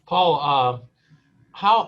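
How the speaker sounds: ordinary talk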